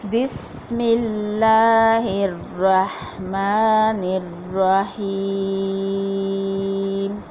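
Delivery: slow, chanted tajweed recitation with long held vowels; Quran recitation. Acted. Teacher reciting Surah Kawthar in full for the class. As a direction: recite the whole surah slowly. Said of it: "ये पूरी सूरत पढ़ती हूँ".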